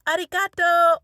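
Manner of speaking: loud, high mock thank-you; husband's hearty thanks. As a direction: imitate someone calling out thanks, oblivious. Re-enacts husband's misplaced cheery thanks.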